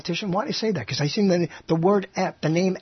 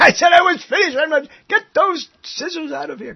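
higher, quicker mock protest; mock indignant architect; 9 semitones higher, 4 dB louder than usual. Cohost imagines the architect at the opening, mock indignant. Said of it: "That's right".

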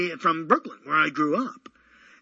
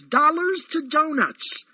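announcing headline; 8 semitones higher, 4 dB louder, slower than usual. Shown as a demonstration. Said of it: "it says".

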